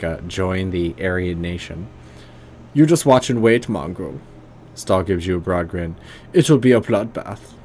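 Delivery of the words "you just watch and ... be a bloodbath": put-on awful German accent. Leader Stahl interrogates the troll in an awful German accent. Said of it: "I'm just giving him a awful German accent".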